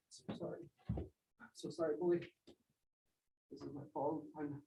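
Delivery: near-silent grieving apology; grief-struck whisper to the dog; broken whisper. Acted. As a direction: whisper the sorry through grief. Grieving over the dying dog.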